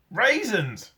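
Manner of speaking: high eager shout. A narrator's reading. Eric shouts his sudden idea to Sid, high shout. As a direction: blurt it out loud. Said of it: "shouted Eric".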